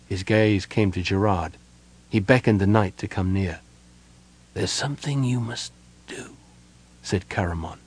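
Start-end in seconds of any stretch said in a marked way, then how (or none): 4.5-6.4 soft, labored dying voice; rasping breath. A narrator's reading. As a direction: push the words out on failing breath. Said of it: "his breath rasping in his chest".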